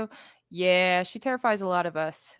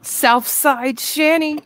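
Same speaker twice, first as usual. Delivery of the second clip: loud nickname call-out; 6 semitones higher, 8 dB louder, much slower than usual. Real, not acted. Calling out a nickname.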